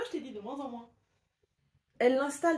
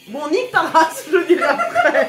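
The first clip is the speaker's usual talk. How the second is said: shout; 6 semitones higher, 13 dB louder than usual. Real, not acted. Shouting then laughing.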